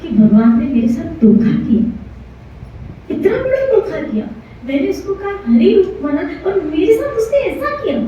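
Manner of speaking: high-pitched aggrieved lament, acting a betrayed devotee; hurt, wronged. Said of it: "उसको इतना दुख". (आहत होकर ऊँचे स्वर में शिकायत)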